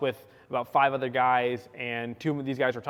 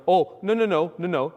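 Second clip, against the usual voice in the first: higher, louder string of no; loud refusal; 4 semitones higher, 5 dB louder than usual. Retold or imitated. Narrator refuses to grab a gun, loud alarmed no. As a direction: shut it down in alarm.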